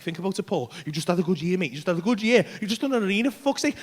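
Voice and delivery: acted sweet-talk from the tempting brain; persuasive. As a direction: talk a mate round, quietly. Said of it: "starts getting all persuasive on me".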